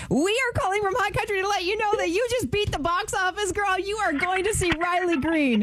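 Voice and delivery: high, thrilled radio host reveal; high-pitched, celebratory delivery; excited prize announcement. Real, not acted. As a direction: break the big news with rising excitement.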